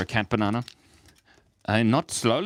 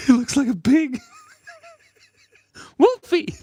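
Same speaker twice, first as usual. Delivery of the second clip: high, loud laugh-cry through the words; laughing hard; 8 dB louder than usual. Real, not acted. Streamer cracks up at chat about his pig-like cat, high loud laughing. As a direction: break up laughing mid-line, voice cracking up high.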